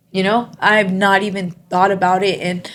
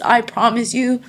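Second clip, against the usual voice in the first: usual speaking voice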